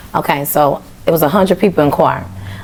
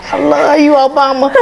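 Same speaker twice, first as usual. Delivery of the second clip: gushing excitement; 10 semitones higher than usual. Retold or imitated. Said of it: "and I was like".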